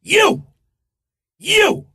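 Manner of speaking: high-pitched imitation scream; screamed across room. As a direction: holler it high, away from the mic. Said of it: "he screams, I'm gonna get away from the microphone".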